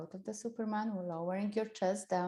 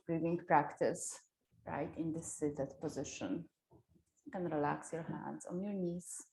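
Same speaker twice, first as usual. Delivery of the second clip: guided meditation voice; 3 dB quieter than usual. Acted. Guides gentle wake-up.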